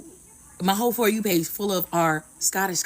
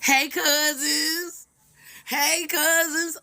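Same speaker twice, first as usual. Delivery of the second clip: high, drawn-out hello to long-lost family; cooing greeting; 6 semitones higher, much slower than usual. Pretend. Greeting newfound Scottish cousins, high drawn-out cooing. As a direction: stretch it out slowly, pitched up.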